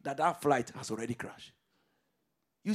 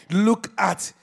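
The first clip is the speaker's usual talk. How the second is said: usual speaking voice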